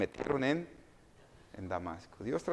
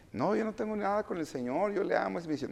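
higher-pitched protest; objecting believer; 7 semitones higher, faster than usual. Acted. Preacher voices a believer denying any quarrel with God, protesting. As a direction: plead innocence in a raised pitch. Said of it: "Uno puede decir".

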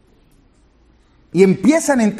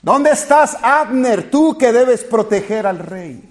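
retelling David's taunting call to Abner, mildly raised; calling out across the hills; 2 semitones higher, slower than usual. Retold or imitated. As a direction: call out to Abner from far off, half-voiced. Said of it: "David empieza a gritar".

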